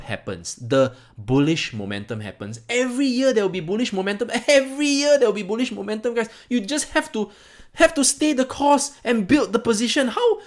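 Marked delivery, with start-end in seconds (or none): 2.7-10.4 high, laughing insistence; laughing exclaim. Real, not acted. Exasperated vlogger laughing at viewers who won't hold. Rant about staying the course.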